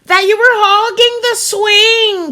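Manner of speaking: high, loud, slow yell; yelled back. A narrator's reading. Katie yelling back at Jenny over the swing, loud and high. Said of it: "Katie yelled back".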